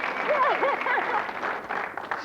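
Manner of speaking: high, breathless giggling fit; burst of laughter. Real, not acted. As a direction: crack up helplessly. Host cracks up at contestant.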